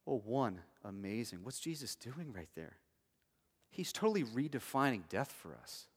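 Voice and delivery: ordinary talk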